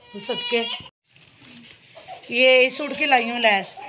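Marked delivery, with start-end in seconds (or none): 0.1-0.6 cooing. Real, not acted. Cooing over the baby.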